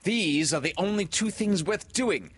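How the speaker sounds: put-on high, loud boy's complaint; indignant boy, loud and high-pitched; growled complaint. A narrator's reading. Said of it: "growled John".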